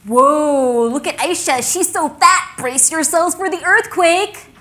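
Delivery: loud, high-pitched mocking jeer; taunting schoolkids. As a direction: holler it like kids teasing a classmate. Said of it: "the kids at school used to say I was fat".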